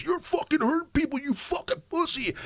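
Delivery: very high, whiny mock of the screaming guy; mocking freak-out. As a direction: pitch him way up, sneering and ridiculous. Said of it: "he's freaking out".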